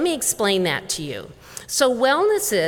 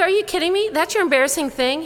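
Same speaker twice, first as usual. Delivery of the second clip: high-pitched retold disbelief; incredulous; 9 semitones higher, faster than usual. Teacher to patient after his small confession, incredulous. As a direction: pitch it way up, as if astonished.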